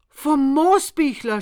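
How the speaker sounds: high, loud horrified cry. A narrator's reading. Narrator cries out in horror at the neighbour's name, high and loud. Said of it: "schrie ich entsetzt".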